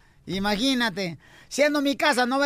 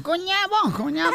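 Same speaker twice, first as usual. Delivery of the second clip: high mock toddler bawl; Salvadoran child crying; 5 semitones higher than usual. Salvadoran child crying to his mamá, mock wail. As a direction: wail it twice like a little kid. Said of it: "Ahí está un niño salvadoreño".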